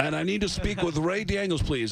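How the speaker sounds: deliberate, self-important rock star. Retold or imitated. Rock star asks for band manager, imperious. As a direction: expect to be put straight through. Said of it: "this is [name]".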